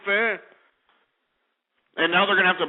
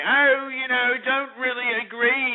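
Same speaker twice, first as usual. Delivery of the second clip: high, hammed-up posh English woman; mock a snooty Englishwoman's accent, pitched way up; pompous English accent; 7 semitones higher than usual. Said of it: "that stupid pompous ass English accent".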